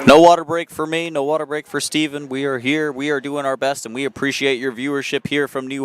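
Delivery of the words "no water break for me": punched out, higher and louder; a cheeky loud protest; louder playful quip. Real, not acted. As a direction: call it out with a grin. Joking after water break called.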